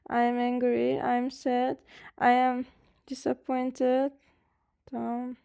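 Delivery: normal talking voice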